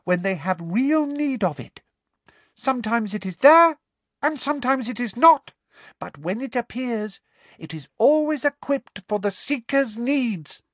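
very high, earnest elf voice; squeaky house elf. A narrator's reading. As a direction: explain the magic room with wonder. Dobby voiced in story.

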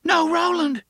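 high, loud horrified cry. A narrator's reading. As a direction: cry out in horror. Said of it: "she cried".

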